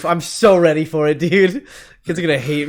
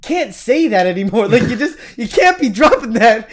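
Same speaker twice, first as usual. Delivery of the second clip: exasperated pleading; 8 semitones higher, 5 dB louder than usual. Retold or imitated. Child scolding dad, acted quote.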